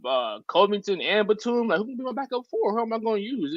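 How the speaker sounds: high, eager rundown of options; sped-up giddy list, pitched well up; excited gamer. Retold or imitated. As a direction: voice a kid thrilled by his roster picks. Gamer picking Clippers in 2K.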